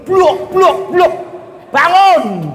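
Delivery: high banging call to wake her; husband banging and yelling at the door; impatient door banging. Acted. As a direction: rap the door and bark wake up.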